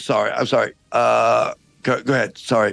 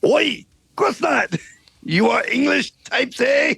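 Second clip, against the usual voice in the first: put-on French accent; 2 semitones higher than usual. French guard calling down from the castle wall, hammed-up French accent. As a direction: ham up a silly French guard. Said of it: "this outrageous accent".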